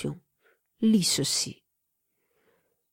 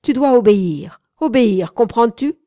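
loud, higher, domineering order; shouted command; 4 semitones higher, 8 dB louder than usual. A narrator's reading. Man ordering the young woman to obey, shouting angrily. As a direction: shout it down at them, demanding obedience. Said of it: "cria-t-il".

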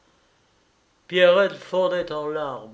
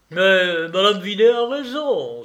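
loud, higher-pitched exclamation; exclaimed agreement; 6 semitones higher, 8 dB louder than usual. Acted. Host seizing on a guest's boarding-school idea, loud exclamation. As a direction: cry out your agreement, voice raised. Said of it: "s'écria Rogron".

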